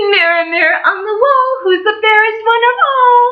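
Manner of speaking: high, loud queen calling to her mirror; high loud vain queen; wicked queen. Acted. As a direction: demand it of the mirror, vain and grand. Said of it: "and she said".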